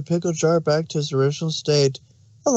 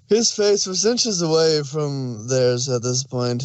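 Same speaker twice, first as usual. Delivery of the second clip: ordinary talk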